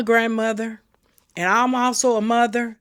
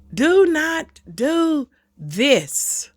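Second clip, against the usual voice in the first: very slow warning; 5 semitones higher, much slower than usual. Shown as a demonstration. Repeats warning more slowly.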